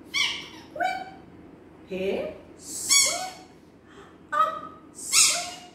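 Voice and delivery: very high toy squeak; rubber ducky squeak. Acted. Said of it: "You know what she said".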